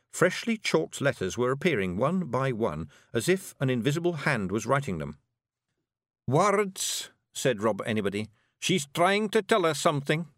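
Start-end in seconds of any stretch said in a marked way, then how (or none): 6.3-10.3 high, slow, broad Scots pictsie voice; a wee blue man laying out a grim plan; pitched-up Scots clan leader, deliberate; Scottish Feegle chief. A narrator's reading. Rob reads the door.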